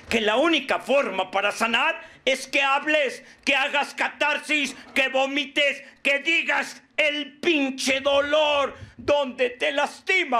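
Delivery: high, insistent harangue; impassioned exhortation. Real, not acted. Speaker urging the group to heal, impassioned exhortation. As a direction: urge them on item by item, stretching key words.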